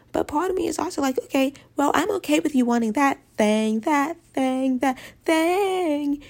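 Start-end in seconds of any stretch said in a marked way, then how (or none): 3.8-6.2 climbing higher on each repeat; mock sing-song. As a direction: tease it, rising each time. Mocking what men want.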